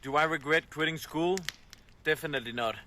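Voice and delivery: usual speaking voice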